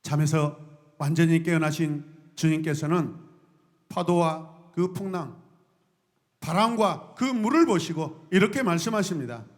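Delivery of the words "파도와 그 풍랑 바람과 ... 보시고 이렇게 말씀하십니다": normal talking voice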